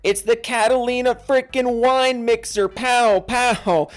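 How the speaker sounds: high, loud hype shout; hammed-up catchphrase. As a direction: belt it out like a big event. Performs a movie catchphrase name.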